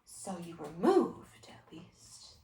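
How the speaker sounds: low, slow near-whisper; quiet and careful after an outburst; hushed reply. Acted. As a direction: answer softly, fading to almost nothing.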